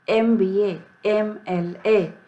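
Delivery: normal talking voice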